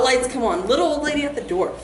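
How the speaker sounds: usual speaking voice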